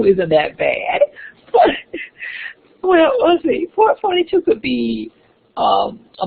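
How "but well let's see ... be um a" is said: laughing. Real, not acted. Laughs at textbook claim.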